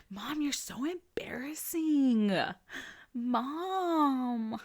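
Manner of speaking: put-on slow, whispered teen groan; mother imitating her son's whispered groan; eye-rolling teenager. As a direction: drag it out like an embarrassed teen. Mom voicing her teenage son.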